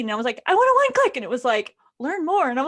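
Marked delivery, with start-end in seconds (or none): 0.5-1.2 high, loud eager squeal; mock impatient demand. Reader wants the book immediately, loud mock demand. 2.0-2.5 higher, louder mock-chirpy prompt; lightly voiced phone. Phone refuses to sell the ebook, mock chirp.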